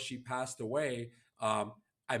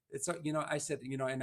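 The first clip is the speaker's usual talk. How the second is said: ordinary talk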